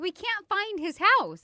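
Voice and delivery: slow, high, loud insistence; exasperated pleading. Retold or imitated. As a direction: retell a fed-up parent's plea, drawn out. Retold exasperated plea to daughter.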